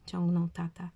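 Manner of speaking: usual speaking voice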